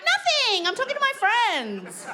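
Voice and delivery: high, squeaky fake innocence; falsetto innocence. Caught out by husband, squeaky innocent reply. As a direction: play innocent in a tiny high voice. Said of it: "I'm like".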